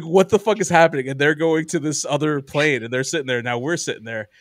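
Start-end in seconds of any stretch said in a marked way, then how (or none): 0.0-1.1 loud, higher-pitched retold disbelief; acted-out baffled exclamation; incredulous outburst. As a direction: burst out with it, louder and higher. Retold alarmed reaction to police arriving.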